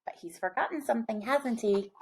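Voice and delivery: hushed aside. Real, not acted. Teasing aside to listeners.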